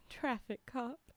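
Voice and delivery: ordinary delivery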